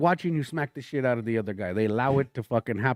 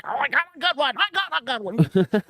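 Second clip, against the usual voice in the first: put-on high, slowed, childish bragging; mock eager heckler; 11 semitones higher than usual. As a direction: play the grown man like an eager kid. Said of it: "grown man back there was like".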